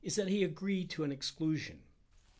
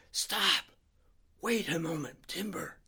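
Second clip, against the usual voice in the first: urgent, higher-pitched strained whisper; straining enormously; 5 semitones higher, 5 dB louder than usual. A narrator's reading. Harry halts his friend crossing the room, straining hard. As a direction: force the words out with great effort. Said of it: "straining enormously to get the words out".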